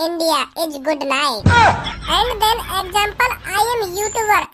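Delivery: very slow bluffing English; string nonsense words slowly, confident; show-off gibberish English; slow fake English.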